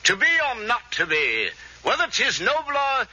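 slow, mock English Shakespearean; James Mason impression. Would-be actor rehearses Hamlet as film star, slow English declaiming. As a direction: declaim slowly like an English screen idol. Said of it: "Let's see, James Mason".

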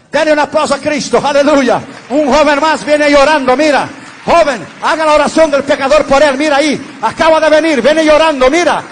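loud, high, fiery shouting preacher; rally the whole church with every line; full-force preaching. Acted. Preacher celebrating a weeping young man kneeling at the altar, fiery shouts.

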